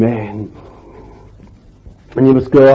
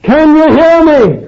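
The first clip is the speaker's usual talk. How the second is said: much higher, louder call, "hear" held long; ringing cry; 14 semitones higher, 5 dB louder than usual. Acted. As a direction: shout it to the whole hall. Preacher's climactic repeated question.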